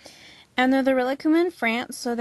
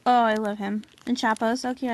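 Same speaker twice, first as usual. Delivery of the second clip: ordinary talk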